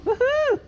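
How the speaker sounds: high excited woohoo call; high whoop. Real, not acted. Whooping at the chickens.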